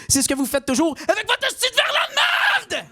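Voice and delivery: ranting at a French waiter, furious. Acted. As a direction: blow up at the waiter.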